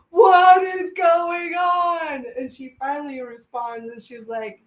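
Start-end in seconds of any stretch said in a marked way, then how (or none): 0.1-2.2 higher, louder, slow wailing question; slow stunned shout; bewildered cry. Retold or imitated. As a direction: cry out in disbelief. Ripples continuing, bewildered.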